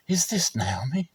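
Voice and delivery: hushed, breathless question; whispered wonder. Acted. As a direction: whisper it in disbelief. Townswomen wondering aloud.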